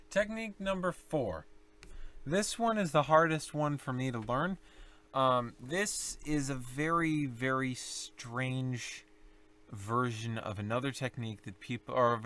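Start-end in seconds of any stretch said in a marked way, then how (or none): none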